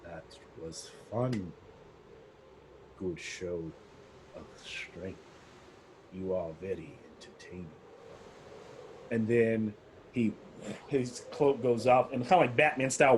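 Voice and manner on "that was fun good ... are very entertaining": low, soft aristocratic villain; Strahd. Acted. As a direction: purr it with amused menace, much lower. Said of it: "And he goes".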